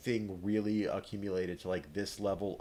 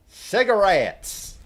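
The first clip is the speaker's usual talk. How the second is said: excited; 10 semitones higher, 12 dB louder, much slower than usual. Real, not acted. Finds cigarettes in the game.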